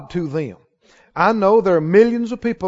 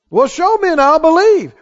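higher, louder voice of a doubter; mock challenge; 7 semitones higher, 5 dB louder than usual. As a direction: throw out an imitated demand, loudly. Acted challenge from an unbeliever.

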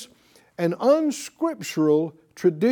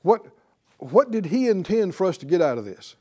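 ordinary delivery

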